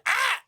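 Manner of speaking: short, very high, loud yelp; yelp of pain. Acted. Game master voicing the gnome hit by hammers, sudden high yelp. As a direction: cry out sharply as if struck.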